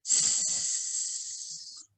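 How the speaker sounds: a long unvoiced hiss, sss; hissed s sound. Shown as a demonstration. Teacher demonstrates the s sound, a long hiss. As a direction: hiss the letter s like a snake. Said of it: "we are going to be learning the sound".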